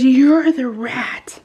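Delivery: slow, exaggerated finger-pointing; mock accusation. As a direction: imitate a daughter catching mom out. Daughter's accusation of mom, retold.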